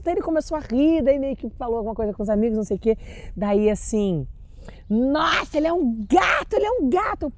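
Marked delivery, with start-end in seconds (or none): 4.9-7.3 gushing fan. Retold or imitated. Fan gushing over the boyfriend.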